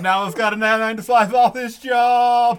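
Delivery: loud, high, elated outburst; real excitement bursting out; excited gushing. Real, not acted. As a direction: gush loudly, stretching the key words. Gushing over new office job.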